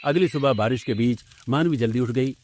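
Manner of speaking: ordinary delivery, nothing marked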